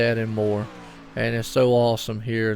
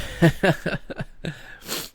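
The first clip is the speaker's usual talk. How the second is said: breathy laugh; 5 semitones higher than usual. Real, not acted. Laughs after embarrassing himself.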